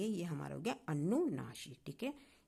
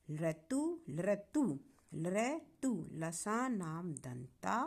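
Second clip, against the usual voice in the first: slow, measured chant of the sutras; slow rhythmic teacher's recitation; slower than usual. Shown as a demonstration. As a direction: drill each sutra slowly so students can memorize it. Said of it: "इसको आसानी से पढ़ने का तरीका है".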